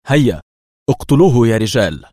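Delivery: loud, decisive order; firm command. A narrator's reading. Levi orders his five men to shoot, loud and firm. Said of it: "وأضاف في حزم".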